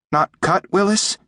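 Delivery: high little creature seeking reassurance; high-pitched, timid question; anxious. A narrator's reading. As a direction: ask worriedly in a tiny high voice, rising. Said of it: "he said anxiously in Jim's voice".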